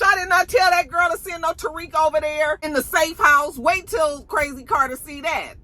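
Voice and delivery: high-pitched indignant rant, imitated; pissed off. Police boss finds out Tariq was sent, mock pissed-off rant. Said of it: "So she's pissed off now".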